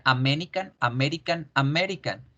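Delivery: "American" with full Spanish A vowels; Spanish-style pronunciation. Shown as a demonstration. Teacher says how Spanish would pronounce it, Spanish-style pronunciation. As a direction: read "American" letter by letter as in Spanish. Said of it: "en español lo pronunciaría".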